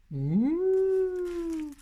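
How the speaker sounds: high, drawn-out "ouh" like a wolf; wolf howl. Pretend. Crafter acting out the wolf for viewers, howling.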